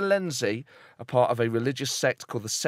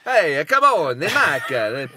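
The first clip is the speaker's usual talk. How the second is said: hammed-up Italian accent impression; Andre Salenzi; 7 dB louder, much slower than usual. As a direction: call out in a mock Italian accent. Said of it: "I'm not gonna do the accent".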